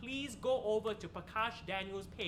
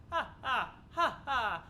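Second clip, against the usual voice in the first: laughing; 4 semitones higher, 4 dB louder, slower than usual. Real, not acted. Laughing at own hacking joke.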